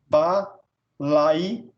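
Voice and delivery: "balaio" broken with pauses as ba, lai, o; split into syllables. Shown as a demonstration. Teacher showing syllables of "balaio", ba-lai-o in separate beats. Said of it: "fica na mesma sílaba".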